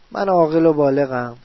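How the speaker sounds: ordinary talk, nothing marked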